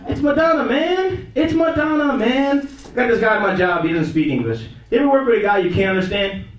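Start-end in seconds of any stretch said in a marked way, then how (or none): 0.1-2.7 high-pitched exasperated correction; squeaky-high disbelief; mock exasperation. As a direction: plead it high, amazed at their ignorance. Exasperated at a wrong quiz answer.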